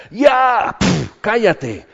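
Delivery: high, loud, exasperated snap to shut up; fed-up shout. Retold or imitated. Preacher telling the enemy to shut up, loud and fed up. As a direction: cut the devil off, fed up. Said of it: "y que digas".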